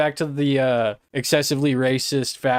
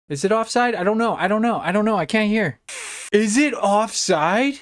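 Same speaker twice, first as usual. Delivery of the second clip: anxious waiting; 9 semitones higher than usual. Real, not acted. Anxious about offside call.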